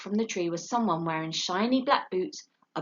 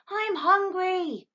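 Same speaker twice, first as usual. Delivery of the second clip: high, slow little owl voice; a small owlet pleading; little owl telling his father he's hungry, high; young owlet; 13 semitones higher, 4 dB louder, much slower than usual. A narrator's reading.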